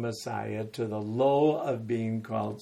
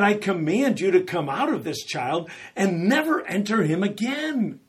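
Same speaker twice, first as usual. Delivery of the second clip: high, loud authoritative command; Jesus casting out the demon, loud and high; commanding rebuke; 7 semitones higher, 8 dB louder than usual. Retold or imitated. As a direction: order the demon out. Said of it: "he rebuked the evil spirit".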